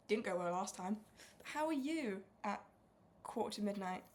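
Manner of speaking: loud posh stream host greeting; hammed-up posh welcome; plummy voice. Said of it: "It wasn't a terrible impression".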